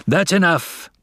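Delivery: high, angry cut-in; blazing anger. A narrator's reading. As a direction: cut him off hotly. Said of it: "blazed Father".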